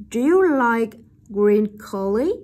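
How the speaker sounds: slow, loud "Do you like green curry?" rising on "curry"; rising intonation. Shown as a demonstration. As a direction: lift "curry" to ask. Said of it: "ngữ điệu đi lên". (nói to, chậm, lên giọng ở "curry")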